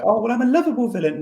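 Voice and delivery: high, fast put-on voice; squeaky-high mocking quote, rattled off; mock lovable villain. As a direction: send up a villain pleading to be liked.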